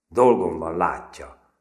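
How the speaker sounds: loud, curt brush-off; gruff. A narrator's reading. Busy girl at the kitchen table to Medve, gruff. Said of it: "mondta gorombán".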